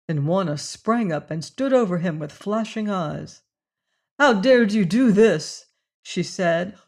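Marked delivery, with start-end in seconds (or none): 4.2-5.6 loud, higher furious accusation; outraged demand, much louder. Acted. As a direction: hurl the question at him. Furious woman confronts Leonard.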